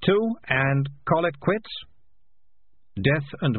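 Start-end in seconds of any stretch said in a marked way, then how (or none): 0.0-1.7 higher, slow urgent bargaining; urgent plea to strike a deal. A narrator's reading. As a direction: press the offer, drawing it out. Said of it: "said Morris urgently".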